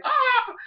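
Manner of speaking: high-pitched mock cry of outrage; upset outcry. Fletchling snubbed by Talonflame, upset high cry. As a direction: let out a high, upset yelp. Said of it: "like, all upset and everything".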